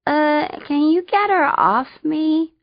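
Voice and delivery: muffled voice from under a weight. A narrator's reading. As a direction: speak as if pinned under fur. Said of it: "my voice muffled".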